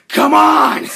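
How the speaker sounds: huge high-pitched shout; captain's scream. A narrator's reading. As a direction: scream it as the crazed captain calling from below. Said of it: "and the captain screams".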